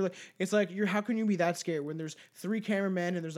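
ordinary talk, nothing marked

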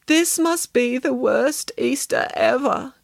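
high, dragged-out lament; theatrical woe. A narrator's reading. Samantha lamenting the worst Easter, slow theatrical woe. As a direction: stretch each word in misery.

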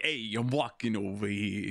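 put-on slow, heavy New York accent; hammed-up New York cabbie voice. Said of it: "No one talks like that in New York".

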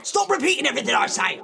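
very fast, high, loud annoyed outburst. Acted. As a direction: rattle it off in exasperation. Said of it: "making me really annoyed".

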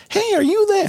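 very high, squealed question; excited recognition. Retold or imitated. People in the hall recognizing him, squealing high. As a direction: squeal like you've spotted a celebrity.

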